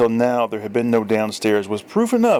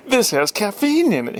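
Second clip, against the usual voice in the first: accusing; 11 semitones higher than usual. Acted. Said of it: "he charged".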